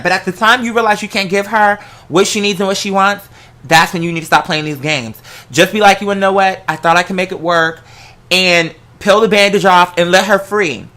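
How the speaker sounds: ordinary talk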